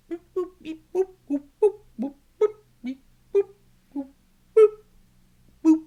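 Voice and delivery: very high, loud beeping; robot beeps. Acted. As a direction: beep like a toy robot.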